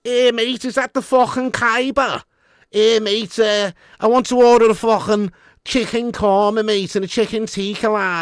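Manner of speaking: high, hammed-up Scouse; thick mock Scouse, starting loud and easing off; Scouse accent. Customer ordering at an Indian restaurant, hammed-up Scouse accent. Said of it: "Do a Scouse accent and order food from an Indian restaurant".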